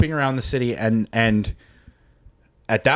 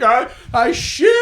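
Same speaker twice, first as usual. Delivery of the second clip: high, slow mock-anguished cry; retold wail; 11 semitones higher than usual. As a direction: wail it in comic despair. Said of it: "I'm just like".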